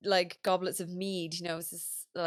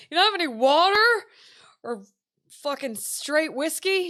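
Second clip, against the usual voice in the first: exasperated tourist; 7 semitones higher, 9 dB louder than usual. Retold or imitated. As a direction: ham up the outraged tourist. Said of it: "They're like".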